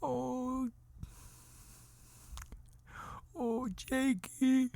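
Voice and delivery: soft, high, slow whimper; drawn-out hammed-up lament; mock crying. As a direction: moan the name in fake grief. Reprise of acted crying.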